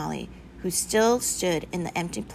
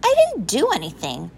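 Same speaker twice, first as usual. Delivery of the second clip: higher, whiny pupil's protest; mildly raised, put-upon voice; indignant denial; 5 semitones higher, 4 dB louder than usual. A narrator's reading. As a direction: protest your innocence to the teacher. Pupil protests being singled out.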